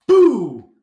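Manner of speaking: high, loud shout of Boo; loud yell. A narrator's reading. Sneaky boys scaring Theo at lunch, loud shout. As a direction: jump out and yell it. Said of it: "yelled the three sneaky boys".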